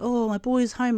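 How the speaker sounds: high-pitched imitated Māori mum; OG Māori lady. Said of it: "that's how she talks".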